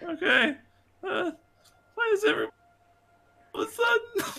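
very high, tearful squeak; mock crying. Corin cowed by the commanding mommy, mock tearful. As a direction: put on a pitiful high whimper. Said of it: "Corin starts to cry".

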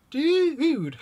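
very high, loud put-on dude; squeaky character dude. Shown as a demonstration. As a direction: squeak it like a cartoon.

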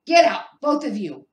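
very loud, high hoarse order; croaked command. A narrator's reading. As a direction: roar them out of the room. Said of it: "croaked Uncle Vernon".

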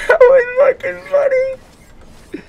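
high, slow words squeezed through laughter; crying with laughter. Real, not acted. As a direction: laugh until it sounds like crying. Laughing hard at teammate's death.

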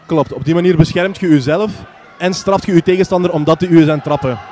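ordinary talk, nothing marked

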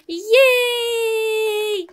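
long, high, loud cheer; joyful long cheer; drawn-out yay. Real, not acted. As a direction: stretch the yay out in triumph. Finishes the level.

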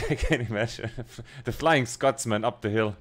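laughing through the words; chuckling about the Scotsman. Real, not acted. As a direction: giggle it out, amused.

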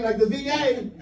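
ordinary talk

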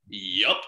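"Yup" read out punchy and final, like a sign in capitals; shouted sign Yup. Retold or imitated. Comic reading the milk sign aloud, a punchy "Yup". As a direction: bark "Yup" like a cheerful all-caps sign. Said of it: "all capitals".

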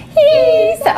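put-on very high little baby voice. Shown as a demonstration. Teacher starting the sentence for pupils in a baby voice. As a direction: squeak it out like a baby. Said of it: "Baby voice".

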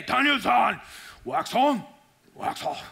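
lightly put-on Miyagi accent; a hint of the wise old master; Miyagi impression. Mentor instructing a boy, light Miyagi accent. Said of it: "no one there in my life to say".

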